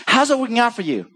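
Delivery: a light mock challenge; wry question. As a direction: tease him with the question. Voicing Jesus' wry question.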